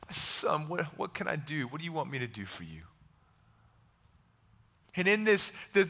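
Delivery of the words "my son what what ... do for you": very soft, low fatherly offer; gently ask, almost under the breath; hushed compassion, lowered voice; tenderness. Acted. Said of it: "we see the tenderness of Jesus".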